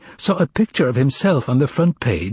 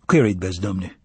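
usual speaking voice

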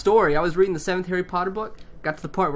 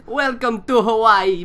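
mock Hawaiian greeting; 6 semitones higher, 7 dB louder, slower than usual.

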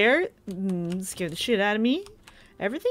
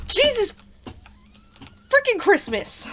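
sharp high gasp into a shocked curse; startled gasp; 4 dB louder than usual. Real, not acted. Player jump-scared in the game, high gasping curse. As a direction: jump and blurt it out.

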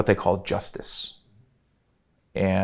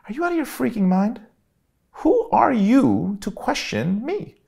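much higher and louder, a street-style rebuke; 6 dB louder than usual. Retold or imitated.

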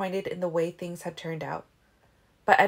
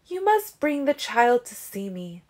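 slow, insistent, higher voice of the wild woman; insisting Zafrina; 5 semitones higher, 4 dB louder, slower than usual. Acted. Zafrina insisting the child visit, slow and firm. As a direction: press the request slowly. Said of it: "Zafrina had insisted".

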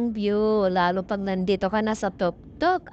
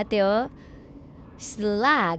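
ordinary talk, nothing marked

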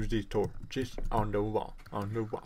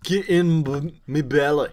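loud, higher-pitched greedy cry; put-on voice, hammed up and loud; failed character impression; 5 semitones higher, 8 dB louder than usual. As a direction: belt it out like a hungry character. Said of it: "That doesn't even sound like him".

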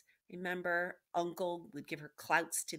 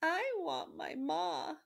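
slow, high, drawn-out wail; high-pitched pleading, lingering on each word; plaintive cry; 11 semitones higher, slower than usual. A narrator's reading. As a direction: stretch it out as a longing cry. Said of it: "cried Meg".

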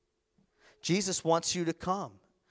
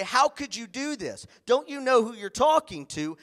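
higher, louder, affronted rebuke; mock indignant scolding; 6 semitones higher, 5 dB louder than usual. Disciples shooing the parents away, a mock indignant rebuke. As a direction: scold them like a self-important gatekeeper.

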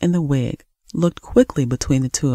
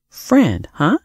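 louder, biting "Friend, huh?"; furious sarcasm; 4 semitones higher, 5 dB louder than usual. Acted. Woman mocking Moses's word for his date, furious sarcasm. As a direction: spit the word friend back at him. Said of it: "she was at nuclear level range".

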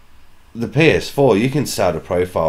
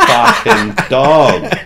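high, louder and very slow, dragged out through a laugh; a mate's mock insult, drawn out and laughing; 8 semitones higher, 4 dB louder, much slower than usual. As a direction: stretch the insult out while laughing. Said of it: "I said".

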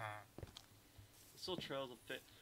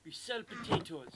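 put-on joking Russian accent, pitched higher; mock Russian accent; 9 semitones higher than usual. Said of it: "No, that's just a joke".

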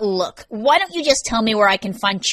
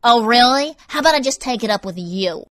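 tag; 6 semitones higher, 5 dB louder than usual. Acted. Irate customer threatens the clerk.

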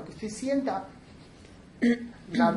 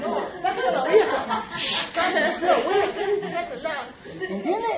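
higher and louder, laughing as she yells; mock laughing shout; 9 semitones higher, 7 dB louder than usual. Teacher hamming up shouting down her grandmother, laughing. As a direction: hush the grandmother in playful outrage.

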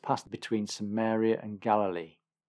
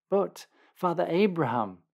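higher, pleading appeal; urging; 7 semitones higher than usual. A narrator's reading. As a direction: press him earnestly. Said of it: "he urged".

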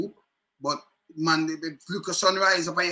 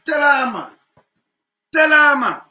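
louder and higher, insistent; loud urging; 9 semitones higher, 11 dB louder than usual. Real, not acted. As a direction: call out hard to the crowd. Urging listeners loudly.

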